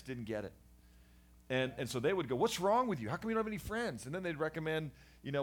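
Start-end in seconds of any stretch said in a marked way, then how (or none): 2.4-4.1 high, louder exasperated scold; exasperated parent. Retold or imitated. Parents scolding their friendless son, high and exasperated. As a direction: nag like a worried, frustrated parent.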